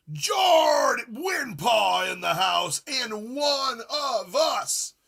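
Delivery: crowd-pumping welcome yell; hype shout. Real, not acted. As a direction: shout the arrival like a ring announcer. Hyping a viewer's arrival.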